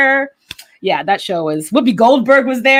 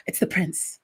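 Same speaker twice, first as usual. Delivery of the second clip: breathless awe; 4 semitones lower, 12 dB quieter than usual. Retold or imitated. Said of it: "I could not breathe 'cause I was like".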